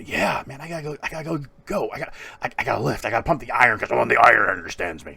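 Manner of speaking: fired-up gym bro rant; mock macho pump-up, rushing to the end; hyped-up macho. Man psyching himself up to lift, hyped-up macho. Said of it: "all that macho bullshit".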